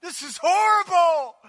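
high, loud wail of regret; mourning crowd crying out; anguished cry. Acted. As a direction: cry out in horror at what they did. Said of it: "They cried".

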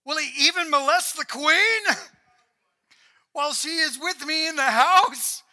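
half-laughing mock outrage; king exclaiming. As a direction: blast it like a shocked, furious king. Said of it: "Then the king exclaimed".

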